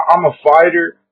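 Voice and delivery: ordinary talk, nothing marked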